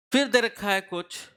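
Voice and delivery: normal talking voice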